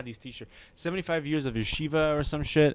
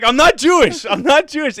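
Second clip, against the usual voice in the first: high, loud protest; imitating his own loud, insistent denial; retold blurted confession; 10 semitones higher, 9 dB louder than usual. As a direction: blurt it out as a desperate correction. Retold panicked confession to stranger.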